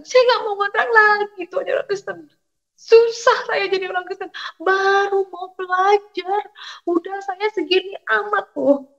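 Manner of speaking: high-pitched wailing protest; retold tearful complaint. New believer complains to God about hardship, retold tearful wail. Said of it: "Saya menangis di belakang kosan".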